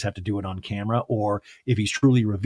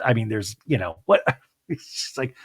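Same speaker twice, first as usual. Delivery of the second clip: ordinary talk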